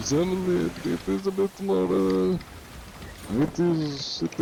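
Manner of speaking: high-pitched attempt at German accent; halting, shaky-footed German accent. Shown as a demonstration. Streamer trying German for viewers, rough German accent. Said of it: "Uh, German".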